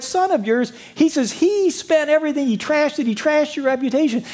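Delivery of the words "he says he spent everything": usual speaking voice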